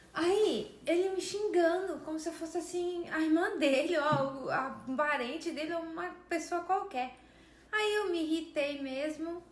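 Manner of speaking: real indignation about being cursed at; indignant outburst. Real, not acted. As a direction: vent the outrage to the viewers. Indignant retelling of insults.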